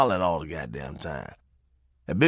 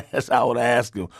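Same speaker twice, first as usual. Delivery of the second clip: ordinary talk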